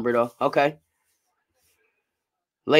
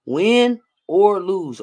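very slow, each word stretched; slow emphasis; 6 semitones higher, 5 dB louder, much slower than usual. Real, not acted. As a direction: spell it out slowly for him. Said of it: "Say it with me".

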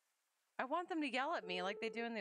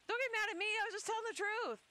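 pleading character voice; 14 semitones higher, 4 dB louder, faster than usual. Acted. Voicing game character's reply.

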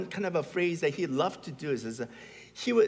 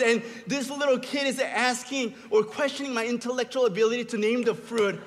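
raised, higher voice, comically outraged; mock indignant rant; 5 semitones higher, 3 dB louder than usual. Fed-up dad defending his fruit knowledge, mock indignant. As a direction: rant with wounded pride, building louder.

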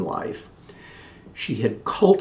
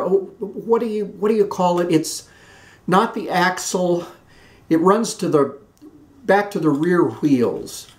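much higher, fumbling for the word; a fussy aunt who can't find the word; high, flustered Southern aunt searching her memory; flustered chatty aunt; 7 semitones higher than usual. A narrator's reading. Aunt Sarah groping for a word.

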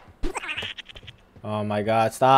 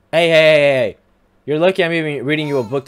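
loud, higher-pitched run of heys; loud protest; 4 semitones higher, 7 dB louder than usual. Real, not acted. Reader interrupted mid-story by the baby, loud protest.

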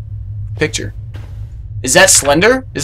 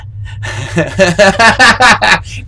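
long chuckling laugh rising in pitch; real laugh; 7 semitones higher than usual. Real, not acted. Gamer amused by the banana rhyme, laughing. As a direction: crack up at your own point.

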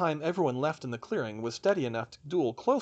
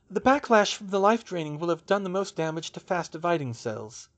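higher, mildly shaken delivery; shaky; 6 semitones higher, 4 dB louder than usual. A narrator's reading. Hermione reasons out the ritual's backlash, a little shakily. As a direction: explain it with a touch of shakiness. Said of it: "she said shakily".

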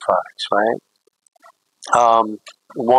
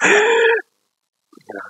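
mock gasp. Shocked at friend's cheeseburger.

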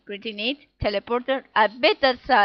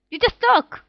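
indignant shout; 11 semitones higher, 6 dB louder than usual. Acted. Arguing with game character.

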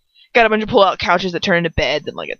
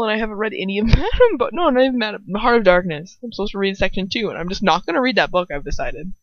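ordinary talk, nothing marked

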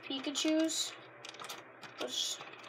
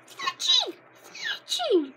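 very high squeaky bird call of the name; Pokemon cry; 8 dB louder, much slower than usual. Retold or imitated. Kid imitating the anime Pokemon cry, very high squeak. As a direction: squawk the name like a tiny bird. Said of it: "how Fletching said it".

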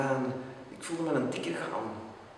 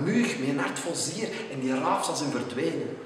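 plain reading voice

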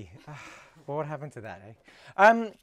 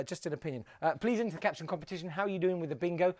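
normal talking voice

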